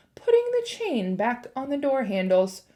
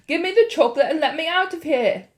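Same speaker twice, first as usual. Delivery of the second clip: fast, louder wary shout; suspicious shout; 6 semitones higher, 5 dB louder, faster than usual. A narrator's reading. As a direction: demand it, growing suspicious. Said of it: "becoming suddenly suspicious".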